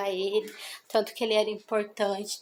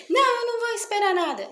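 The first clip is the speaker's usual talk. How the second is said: high-pitched rushed no; impatient refusal; 10 semitones higher, 4 dB louder, faster than usual. Retold or imitated. Mother in a hurry refusing her son, impatient high snap. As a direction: brush the kid off, no patience left. Snapping at son who asks.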